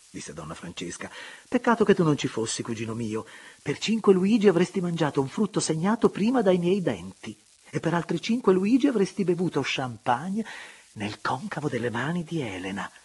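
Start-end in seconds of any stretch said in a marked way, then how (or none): 1.5-12.9 higher, louder playful gossip; lightly voiced teasing lady. A narrator's reading. Francesca teasing her cousin about the fair, high and gleeful. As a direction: tease the cousin with relish.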